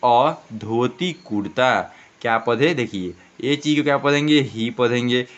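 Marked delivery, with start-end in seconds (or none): 0.1-1.9 slow, word by word for learners; slow model reading. Shown as a demonstration. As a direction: read it out slowly like a teacher. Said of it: "तो क्या पढ़ते हैं". (धीरे-धीरे पढ़कर सुनाना)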